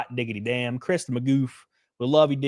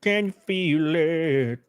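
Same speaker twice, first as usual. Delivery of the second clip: slow tease; 3 semitones higher, much slower than usual. Acted.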